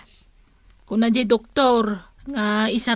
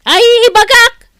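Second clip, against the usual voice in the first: angry retort; 10 semitones higher, 8 dB louder than usual. Acted.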